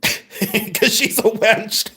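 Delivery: slow, giggling through the words; laughing at her own joke. Real, not acted. Host laughing to viewer about the wench.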